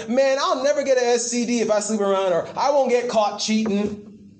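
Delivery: mocking careless sinner. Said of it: "We laugh at God and say".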